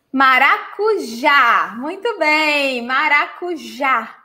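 Maracujá slow, weight landing on the final já; stress on the last syllable. Shown as a demonstration. Teacher slowly modelling the stress of Maracujá for students. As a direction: draw out Maracujá and hit the final já. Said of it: "the stress syllable is the last one".

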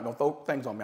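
ordinary talk, nothing marked

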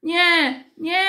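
put-on high, loud little-girl refusal; acted high-pitched childish cry; child shouting. A narrator's reading. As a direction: squeal it like a stubborn small child. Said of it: "krzyczała mała Kasia".

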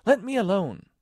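high, loud demand; indignant high-pitched outburst. A narrator's reading. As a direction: order them off in an offended girl's voice. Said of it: "said Alice indignantly".

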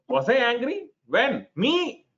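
high, incredulous mock protest; acted-out wounded surprise at being accused; indignant denial. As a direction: squeak it in offended disbelief. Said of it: "If that is how I'm reacting".

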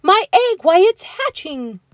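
high, loud, amazed cry; excited shout. A narrator's reading. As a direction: shout it in astonishment. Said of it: "shouted Horton".

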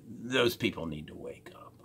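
hushed snide aside; a whispered jab at the rival. Real, not acted. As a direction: whisper the dig under your breath. Muttered dig at rival.

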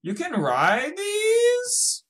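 high, drawn-out amazed question; astonished squeal. Real, not acted. Player learning these can be ridden, astonished squeal. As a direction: stretch it up into a slow shriek. Surprise discovery in game.

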